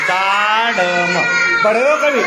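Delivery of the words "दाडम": दाडम stretched out syllable by syllable; sounded out slowly. Shown as a demonstration. Teacher reading दाडम for the class, drawn out slowly.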